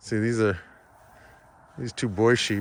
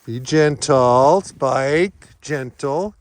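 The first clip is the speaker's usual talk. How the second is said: louder, slow warning to the dog; firm command; 3 semitones higher, 6 dB louder, much slower than usual. Real, not acted. Owner warning her dog Spike near the sheep, firm. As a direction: hold the dog back firmly.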